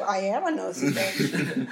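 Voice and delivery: laughing admission. Real, not acted. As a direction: own it with a laugh.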